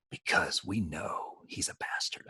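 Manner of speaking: slow, breathy retold whisper. Actor whispering about Tom Paris on set, slow and hushed. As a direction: hush it so the guy walking in can't hear. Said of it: "We were whispering all this".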